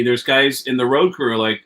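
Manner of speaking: plain reading voice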